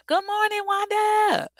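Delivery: high, loud Southern greeting shouted to Wanda; proud Southern accent call across the room. Shown as a demonstration. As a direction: belt out "Good morning, Wanda" in a thick Southern accent. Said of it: "I didn't think I lost my accent".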